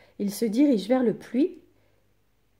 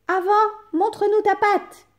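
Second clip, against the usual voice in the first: put-on high, loud demanding kids; little goats insisting; kids shouting; 13 semitones higher, 7 dB louder than usual. A narrator's reading. Kids demanding to see the paw, high and loud. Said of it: "crient les chevreaux".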